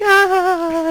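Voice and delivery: high imitated shriek of surprise; mock girls' shriek. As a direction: squeal it high like startled girls.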